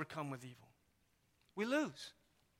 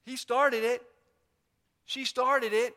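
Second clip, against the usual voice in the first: higher, louder sibling squabble; whiny child; 4 semitones higher, 6 dB louder than usual. Retold or imitated. Preacher imitating children, loud indignant whine. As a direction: tattle to the parent, indignant. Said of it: "my children saying it".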